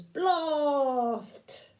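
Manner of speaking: a hint of robot boom. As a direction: toy robot shout. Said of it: "And the robot goes".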